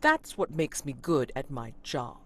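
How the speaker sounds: slow, drawn-out menace; menacing constable. Acted. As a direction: lean in and savour each word.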